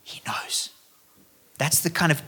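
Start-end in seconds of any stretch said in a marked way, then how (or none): 0.0-0.7 a breathy hush of awe; whisper. Real, not acted. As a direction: drop to a reverent whisper. Hushed climax of sermon point.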